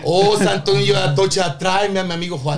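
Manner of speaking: hammed-up plea to San Antonio; mock prayer.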